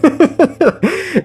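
a sudden high cackle that falls away; short falling high laugh; high squeaky laugh. Real, not acted. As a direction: crack up at your own joke. Laughing at own joke.

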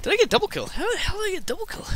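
disbelieving high voice; amazed at your own luck; surprised. Real, not acted. Surprised at double kill.

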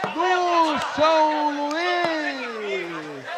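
rising play-by-play into a big goal shout; explosive goal commentary. Acted. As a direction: build it up, then roar GOL.

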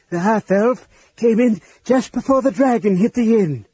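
high, quavering account of disaster; trembling voice. A narrator's reading. Elistan recounting the inn's collapse to Sturm, trembling. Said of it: "Elistan said in a trembling voice".

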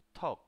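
"Talk" as Korean "teok", airy T; short model "Talk" with unreleased ending; Korean pronunciation demo. Shown as a demonstration. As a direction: puff the T of "Talk" and stop the k short. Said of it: "así es como pronuncia".

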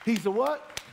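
loud rising call for the answer; call to congregation. Acted. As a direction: prompt the crowd, loud and high. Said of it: "I'm gonna say it again".

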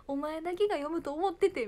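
high, loud mock protest; dad's excuse. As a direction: plead as the embarrassed father. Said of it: "パパはいまだにいじってる". (父親の必死な言い訳口調)

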